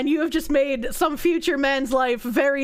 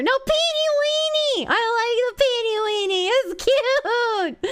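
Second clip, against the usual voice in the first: cute squeal; 9 semitones higher than usual. Pretend. Insisting on the cute word.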